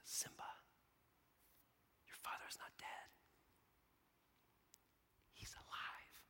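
very soft storyteller whisper; acted mystical mentor, whispered; hushed revelation. Acts Rafiki speaking to Simba.

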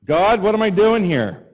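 loud, high, slow cry to heaven; exasperated cry. Retold or imitated. Pastor loudly crying out to God in mock despair. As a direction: throw the question up at God. Exasperated prayer after tiny tank.

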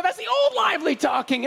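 mock yell with a high, insistent push. Daughter growing bolder against the lie, high mock yell. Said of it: "then she starts getting louder".

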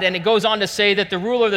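ordinary talk

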